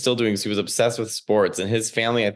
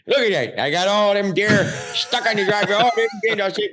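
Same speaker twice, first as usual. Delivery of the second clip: put-on higher, excited uncle in thick Chicago accent; proud Chicago uncle; 9 semitones higher than usual. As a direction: boast like a South Side Chicago uncle. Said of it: "He was like".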